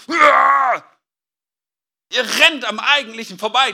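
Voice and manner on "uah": a loud frustrated roar; mock angry roar. Paul, furious over the Galatians, roaring. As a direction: let out a furious growl. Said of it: "ist er wahrscheinlich so völlig".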